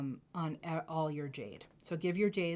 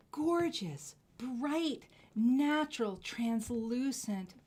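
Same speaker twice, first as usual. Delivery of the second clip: excited; 6 semitones higher, slower than usual. Real, not acted. Listing jade's qualities excitedly.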